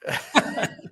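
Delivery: squeaky burst of laughter; high giggle. Real, not acted. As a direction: laugh high at your own joke. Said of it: "not so good".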